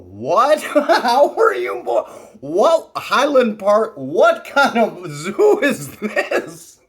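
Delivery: loud, high, slow, laughing mock outrage; laughing disbelief. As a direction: howl the questions through laughter. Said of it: "The boys everywhere on earth go".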